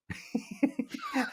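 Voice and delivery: a high unvoiced chuckle of disbelief; amused breathy laugh. Real, not acted. Father laughing at his daughter's challenge, airy chuckle. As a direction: laugh at the cheek of it. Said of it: "I almost laughed out loud".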